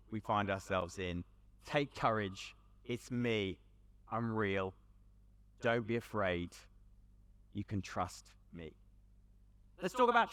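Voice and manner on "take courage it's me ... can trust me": tender reassurance. Retold or imitated. Repeating Jesus' words, tender.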